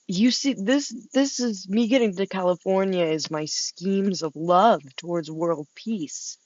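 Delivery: ordinary delivery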